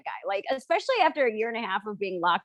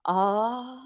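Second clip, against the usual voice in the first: skeptical drawl; 3 semitones lower, much slower than usual. Retold or imitated. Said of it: "she just goes".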